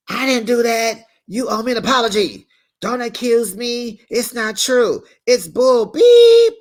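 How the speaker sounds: high, loud building yell; hollering. Retold or imitated.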